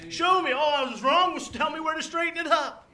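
loud, high, fired-up pleading; urgent, shouted eagerness from the pulpit; eager believer. Acted.

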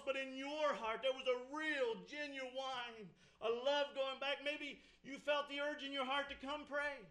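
urgent pulpit delivery; forceful preaching. Real, not acted. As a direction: press the congregation hard, punching key words.

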